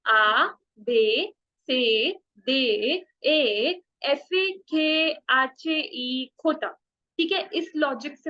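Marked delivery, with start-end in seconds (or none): 0.0-6.8 very slow, each letter drawn out; slow teacher's drill, letters stretched apart; slow letter recital. Shown as a demonstration. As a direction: spell the alphabet slowly for pupils to copy. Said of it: "आप मेरे पीछे बोलोगे".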